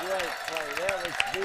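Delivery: loud excited commentary; excited shouting. Real, not acted. Commentator hailing the rally of the tournament, excited shout. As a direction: shout it over the roaring crowd. Crowd erupts at great rally.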